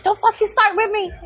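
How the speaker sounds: high-pitched mock telling-off; retold exasperated warning. Mom warning son who talks back, mock exasperated. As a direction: warn the kid off, voice shooting up.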